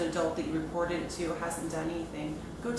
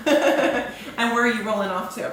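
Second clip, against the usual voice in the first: real laugh, louder and higher; amused laughing; 5 semitones higher, 9 dB louder than usual. Real, not acted. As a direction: laugh loudly, then ask through the amusement. Amused at child's rolling away.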